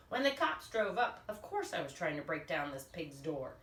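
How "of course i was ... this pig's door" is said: ordinary delivery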